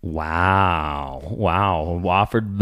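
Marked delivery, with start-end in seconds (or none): none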